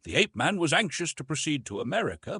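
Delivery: plain reading voice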